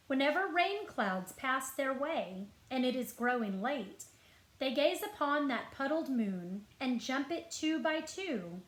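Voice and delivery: usual speaking voice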